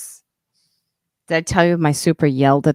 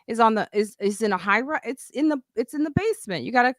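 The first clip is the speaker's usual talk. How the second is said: much higher, incredulous explaining; exasperated; 6 semitones higher, faster than usual. Retold or imitated. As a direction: talk her through it like it's obvious, pitched way up. Her exasperated reply to Yamaneika.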